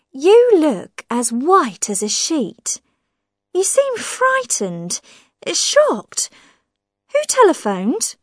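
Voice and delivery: high, probing voice of a suspicious woman; prying sister-in-law. Acted. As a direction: needle him with pointed questions. Millicent voiced in the story.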